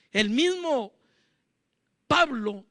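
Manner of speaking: ordinary talk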